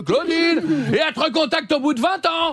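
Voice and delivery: high, thrilled cry of recognition; a delighted old schoolmate calling out her name; high excited mock reunion voice. Old classmate's excited call.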